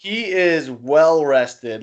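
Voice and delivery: slow teasing. Real, not acted. Teasing about a rested player.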